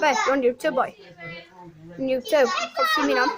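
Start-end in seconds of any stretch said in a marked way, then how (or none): 2.5-3.3 squeaky high voice. Pretend. Teasing monkeys, squeaky.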